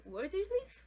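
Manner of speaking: WHERE DO YOU LIVE rising high at the end; second-time question. Shown as a demonstration. Teacher shows asking again after forgetting, rising intonation. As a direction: ask "Where do you live?" as if you didn't catch it, tune climbing. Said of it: "it can sound like as the second time".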